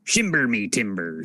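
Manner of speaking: a slow put-on Irish pirate voice; Irish pirate voice, hammed up; mock Irish pirate. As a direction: ham up a pirate brogue, slowly. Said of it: "can you do a, an Irish accent".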